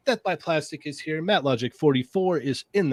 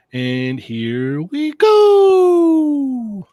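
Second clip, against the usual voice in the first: drawn-out announcer call; 10 semitones higher, 7 dB louder, much slower than usual. Acted. Host's dramatic show intro.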